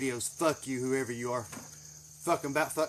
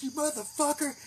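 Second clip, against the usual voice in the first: indignant mock yell; retold yell; 11 semitones higher than usual. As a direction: replay the outrage at a held-back volume. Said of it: "I fucking yelled".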